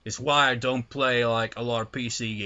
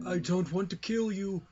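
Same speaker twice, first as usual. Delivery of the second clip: higher-pitched mimicry of a game character; mock voice acting; 7 semitones higher than usual. Gamer mimicking the game's line, mock voice acting. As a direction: put on an accented game-character voice. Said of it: "That reminds me of the first game's voice acting".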